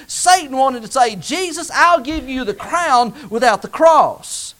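loud, ringing pulpit delivery; fiery preacher calling out names; preaching at full force. Acted. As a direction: drive every phrase home to the congregation.

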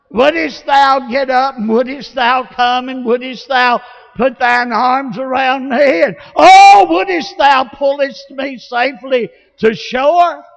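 loud, high, overblown pleading; mock lengthy prayer. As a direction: build a hammed-up King James plea to a peak. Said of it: "I didn't try to make some lengthy prayer".

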